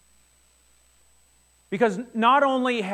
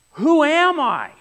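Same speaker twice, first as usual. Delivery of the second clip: louder, slow cry of a lost widow; anguished question; 9 semitones higher, 8 dB louder, slower than usual. Retold or imitated. A widow asking who she is now, loud slow anguish. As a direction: let the loss break out as a plea.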